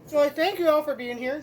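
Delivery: talking with a mouthful of food; mouth full. Real, not acted. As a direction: say it round a bite of food. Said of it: "I'm doing this with my mouth full".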